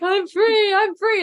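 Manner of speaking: high, loud triumphant cry; hammed-up shout of liberation; imitated jubilant escapee. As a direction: whoop it out, newly freed. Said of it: "that guy from The Hunchback of Notre Dame".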